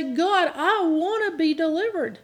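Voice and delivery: mock desperate plea, held high; crying out to God. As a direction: beg God to set you free. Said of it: "really cry out and say".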